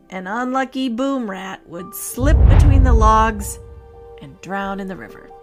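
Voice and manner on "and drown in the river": plain reading voice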